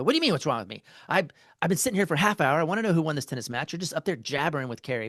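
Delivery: loud, fast, angry retelling of a snap; angry outburst. Retold or imitated. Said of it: "And I got livid".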